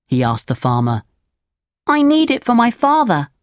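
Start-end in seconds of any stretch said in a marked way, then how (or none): 1.9-3.3 put-on very high, polite puppet boy; Pinocchio, pleading. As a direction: plead like a little boy asking a favour. Pinocchio begs the farmer.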